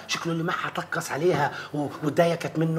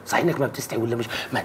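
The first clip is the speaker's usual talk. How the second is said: ordinary talk, nothing marked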